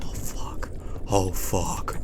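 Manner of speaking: scared low whisper. Real, not acted. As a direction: breathe it out in dread. Whispered in fear.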